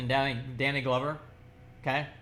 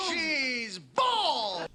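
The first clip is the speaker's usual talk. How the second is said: high, shouted mock surprise; playful shocked shout; exclaimed shock; 10 semitones higher than usual. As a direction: blurt it out like a surprised gasp. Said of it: "just a surprise".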